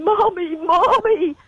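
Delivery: high, drawn-out cry of Mommy; distraught teenage son. Retold or imitated. Mother retelling airport reunion with son, high imitated wail. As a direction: wail it as a boy running to his mother. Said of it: "he rushed into my arms and said".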